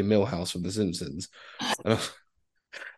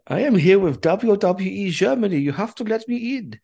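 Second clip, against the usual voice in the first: higher, hammed-up German accent; pompous German accent demanding entry; [name]'s German accent; 12 semitones higher, 5 dB louder than usual. Demanding the security guard let him in, mock German accent. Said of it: "not as inappropriate as his German accent".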